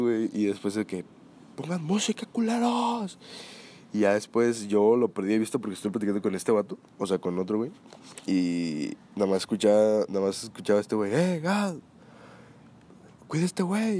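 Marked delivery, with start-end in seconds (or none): 1.6-3.2 put-on high, slurred, rising drunken demand; mock drunk holler, very high at the end; drunk friend's voice. Drunk friend demanding music third time. 11.1-14.0 calling out. Retold or imitated. Someone calling out across party.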